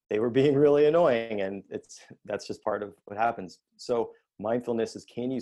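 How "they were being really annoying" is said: a louder, higher whine of blame; mock annoyed complaint. A parent griping about annoying family, mock-annoyed. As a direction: gripe like someone fed up with family.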